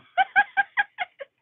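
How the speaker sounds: sudden squeal of a laugh, high up. Real, not acted. Laughs at gap tooth.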